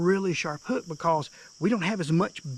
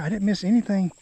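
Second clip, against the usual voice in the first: ordinary talk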